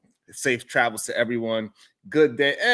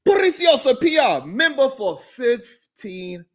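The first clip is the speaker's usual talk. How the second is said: high, loud hype shoutout; excited; 11 semitones higher, 5 dB louder than usual. Real, not acted. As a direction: thank them like they just won.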